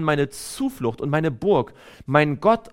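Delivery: ordinary talk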